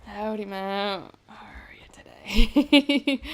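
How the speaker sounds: slow, playful Southern American drawl; Southern American accent. Shown as a demonstration. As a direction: drawl it out like a Texan, slowly. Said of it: "I'm picking up their accent".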